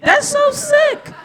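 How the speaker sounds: squeaked way up, slow and laughing; high mock outrage. Comic mocking socialism talk, slow squeaky laugh. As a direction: screech it in fake horror.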